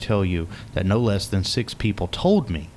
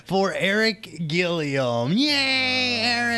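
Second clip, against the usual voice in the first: high-pitched, cheering welcome; hyped-up show intro; announcer-style introduction; 9 semitones higher, slower than usual. Real, not acted. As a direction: bring the guest on with a drawn-out cheer. Host introduces guest to applause.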